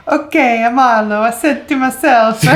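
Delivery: loud, high mock Borat accent. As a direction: ham up a Borat voice. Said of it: "Sounding like Borat".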